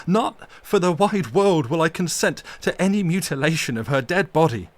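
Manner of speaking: loud passionate fury defending her grave; storm of passion. Acted. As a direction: rage and plead against the desecration. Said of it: "cried Arthur in a storm of passion".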